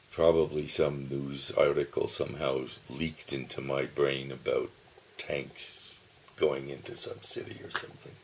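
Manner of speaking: usual speaking voice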